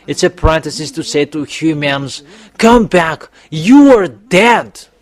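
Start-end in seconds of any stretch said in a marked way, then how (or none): 2.6-4.9 loud, drawn-out call to come back; emphatic call. Acted. Preacher calling his listeners back, loud and slow. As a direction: cry it out like a warning to the dead.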